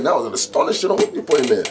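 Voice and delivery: high, loud admiring rave; imitated hyped praise. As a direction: rave like an impressed fellow MC. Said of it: "and boost you".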